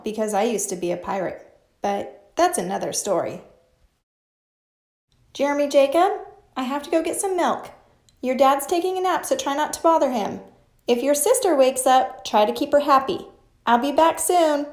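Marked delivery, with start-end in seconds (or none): 5.3-14.7 higher, louder, quick motherly instructions; mom's voice. Acted. Mom telling Jeremy Jacob the house rules, quick and raised. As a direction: rattle off orders like a busy mom.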